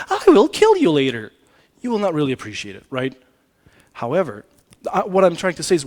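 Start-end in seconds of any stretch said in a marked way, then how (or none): 0.1-1.3 mock threat. Shown as a demonstration. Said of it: "having the best tone of voice".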